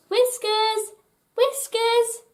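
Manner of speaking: high, carrying calls; high calls searching for a friend; calling out. A narrator's reading. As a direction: call out for the hidden cat.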